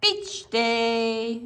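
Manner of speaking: usual speaking voice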